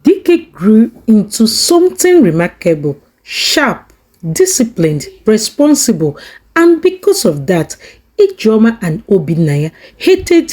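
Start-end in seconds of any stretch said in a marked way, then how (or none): none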